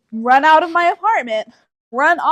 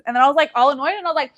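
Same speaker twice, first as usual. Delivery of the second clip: ordinary talk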